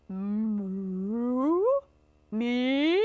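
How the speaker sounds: long mooing question, rising at the end; drawn-out cow voice, sliding upward; bewildered cow. Acted. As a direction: moo the words in puzzled disbelief.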